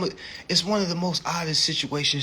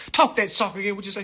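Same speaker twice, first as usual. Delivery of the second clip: mock country cop; 10 semitones higher, faster than usual. Cop sneering at the driver, mock country voice. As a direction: lean on every boy like a condescending cop. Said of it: "He said".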